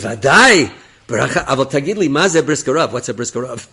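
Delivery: loud, eager burst easing into a question; eager exclamation. Retold or imitated. Officer jumps at a blessing offer, eager and loud. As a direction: jump at the offer loudly, then ask.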